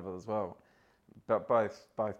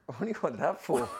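incredulous; 5 semitones higher than usual. Retold or imitated. Asks about sauce.

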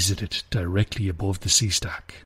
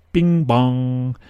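loud, raised two-note call; announcer's shout; 4 semitones higher, 5 dB louder than usual. A narrator's reading. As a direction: shout it out like a chime. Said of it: "whose only job was to shout".

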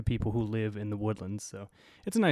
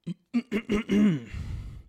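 throat clear; 9 semitones higher, 3 dB louder than usual. Real, not acted. Before reading the article aloud.

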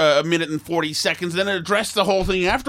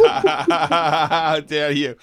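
mock outrage; much slower than usual. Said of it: "mock offense at a joke".

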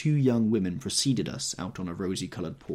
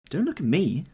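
sharp, higher retort; 8 semitones higher, 6 dB louder than usual. Acted. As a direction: toss it back at her. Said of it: "Daisy retorted".

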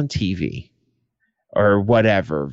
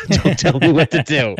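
laughing mock defiance; 3 semitones higher, 3 dB louder than usual. Repeats it laughing.

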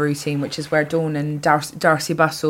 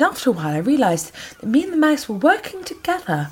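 higher, lightly acted storyteller's voice; Imelda Staunton; 7 semitones higher than usual. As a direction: play the actress delivering her anecdote, pitched up. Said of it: "She said".

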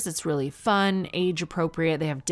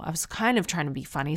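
usual speaking voice

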